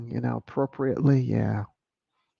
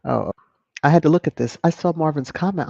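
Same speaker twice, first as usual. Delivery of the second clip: ordinary talk, nothing marked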